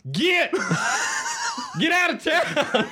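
high, hammed-up shooing yell; mock yokel yelling get out of town. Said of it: "The answer to all of them are".

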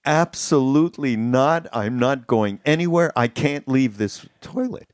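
hammed-up stubborn friend refusing to move; adamant refusal. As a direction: dig in, won't budge. Friend refusing to leave room.

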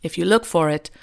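a light, badly faked British accent; terrible Hugh Grant impersonation. Reading the film's opening voiceover in a mock British accent. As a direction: read it like a shaky stab at British. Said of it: "Excuse my terrible Hugh Grant slash shitty impersonation".

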